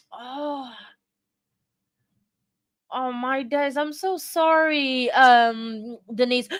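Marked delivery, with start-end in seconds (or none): none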